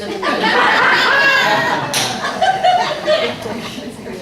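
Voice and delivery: loud high burst of laughter; audience-style belly laugh; big laugh. Real, not acted. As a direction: let the laugh roll out. Laughing at the story.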